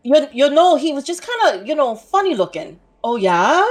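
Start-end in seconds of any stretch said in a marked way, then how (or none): 3.0-3.6 a mock Fargo-accent "Oh, yeah?", louder. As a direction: imitate the Fargo accent, loud, with a rising tone. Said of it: "my favorite was the".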